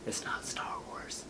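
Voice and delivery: whispered aside, slow; hushed whisper, slow. Acted. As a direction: let them in on a secret in a whisper. Secret aside to viewers.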